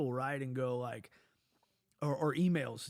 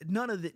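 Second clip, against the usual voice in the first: "none" punched higher and louder; emphatic none; 5 semitones higher, 5 dB louder than usual. Real, not acted. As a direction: stress "none" high and loud. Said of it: "is what I'm trying to tell you".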